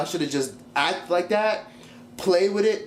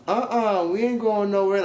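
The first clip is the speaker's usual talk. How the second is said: higher, defiant refusal; imitating Queen; 6 semitones higher than usual. Vlogger voicing Queen refusing to leave, defiant. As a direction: dig your heels in, sassy. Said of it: "Queen was like".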